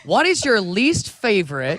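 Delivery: put-on slow, careful American accent. British guest quizzing his friend, in an American accent. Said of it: "in an American accent".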